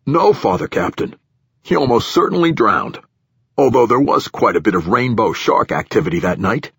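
official giving his account to a superior, a bit pushed; nervous platform director. Acted. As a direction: voice an uneasy director justifying himself.

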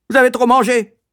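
high, loud accusing bark. A narrator's reading. Matron dismissing the boy's bellyache, barking. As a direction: snap it out as a harsh matron. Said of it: "aboya-t-elle".

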